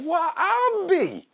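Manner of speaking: put-on very high, drawn-out old-geezer exclamation; astonished old man. Old neighbor amazed at the chickens, high drawn-out exclamation. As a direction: stretch it slowly, way up high, in amazement. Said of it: "the old man's like".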